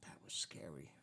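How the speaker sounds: soft breathy whisper, barely voiced; whispered admission of fright. Real, not acted. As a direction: hush it, still shaken. Recalling the fire tower fear.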